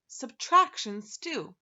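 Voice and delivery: drawn-out proud announcement; slow, booming dish name; loud and slow. Acted. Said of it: "It's a specialty of the kingdom".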